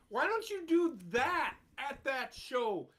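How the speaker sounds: ordinary talk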